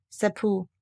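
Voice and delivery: plain reading voice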